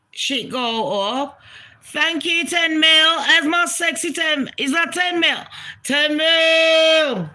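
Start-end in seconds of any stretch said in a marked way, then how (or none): 1.8-7.3 excited thanks. Real, not acted. Thanking a big live donation.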